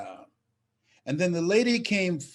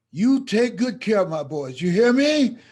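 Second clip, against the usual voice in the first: urgent demand; 6 semitones higher, 7 dB louder than usual. Retold or imitated. Birth mother's final demand.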